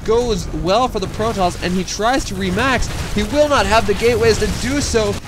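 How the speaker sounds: high, loud, fast play-by-play hype; really excited. Real, not acted. Tired caster rallying the viewers for the final battle, roaring excitedly. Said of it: "put one last burst of energy into getting really excited".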